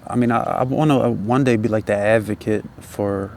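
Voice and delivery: usual speaking voice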